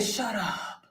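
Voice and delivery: low, soft "shut up"; hushed disbelief. Retold or imitated. Commentator reacting to the AI rumor, hushed disbelief. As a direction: say it under your breath, dropping away.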